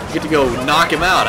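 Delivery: usual speaking voice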